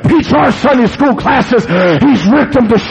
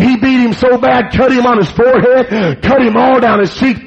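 ordinary talk

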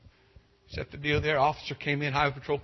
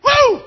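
high, loud whoop of relief; joyful whoop; 16 dB louder than usual. Real, not acted. Preacher celebrating his dismissed ticket, a loud joyful whoop. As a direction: let out a triumphant whoop.

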